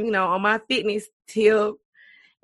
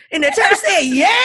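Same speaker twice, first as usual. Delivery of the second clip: laughing shout; 7 semitones higher, 7 dB louder than usual. Retold or imitated. Mocking call and response.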